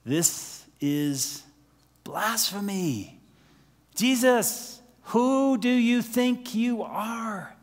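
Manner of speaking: slow, high, scandalised cry of accusation; outraged scribes. Retold or imitated. Preacher voicing the scribes challenging Jesus, slow and outraged. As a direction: drag out the blasphemy charge like an offended religious teacher. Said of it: "did you notice how they responded?".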